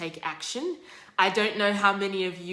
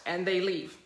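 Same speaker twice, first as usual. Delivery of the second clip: ordinary talk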